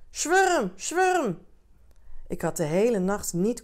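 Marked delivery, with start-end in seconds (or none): 0.1-1.4 high, loud crowd call; roepende bewonderaars. A narrator's reading. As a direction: call out for someone up at a window. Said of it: "en riepen". (hoog en luid roepen)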